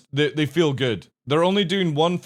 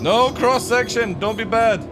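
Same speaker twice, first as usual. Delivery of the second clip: pleading shout; 8 semitones higher, 5 dB louder than usual. Real, not acted. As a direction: beg the ship out loud. Pleading with ship mid-fight.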